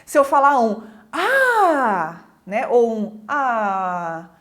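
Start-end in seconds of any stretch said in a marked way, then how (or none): 1.1-2.2 long drawn-out delighted "ah"; big, stretched exclamation; changed intonation. Shown as a demonstration. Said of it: "se eu mudar a entonação". 3.3-4.3 long, low, deflated "ah"; low, drawn-out sigh of disappointment; changed intonation. Shown as a demonstration. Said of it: "se eu mudar a entonação".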